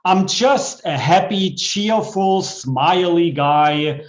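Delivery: bouncy comic poem reading with a rhyme beat; poem recital. Acted. Poet reciting a comic poem to the open mic. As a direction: perform the silly rhyme for the crowd.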